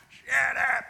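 high, loud exasperated yell; sharp shouted command to a barking dog. Acted. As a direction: holler it from the back porch. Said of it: "it's what you'd yell at your dog from the back porch".

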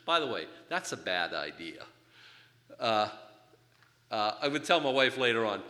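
normal talking voice

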